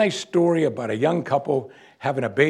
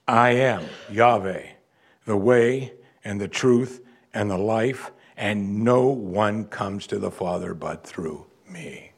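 solemn slow reading; 3 semitones lower, slower than usual. Acted. Solemn quote of Jesus' claim.

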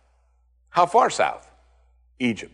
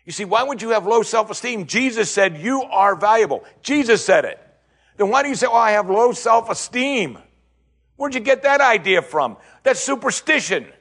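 high, insistent preacher's challenge; exasperated sermon climax; impassioned preaching; 9 semitones higher, 4 dB louder than usual. Acted. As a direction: press the congregation hard, pitch held high. Preaches at full force.

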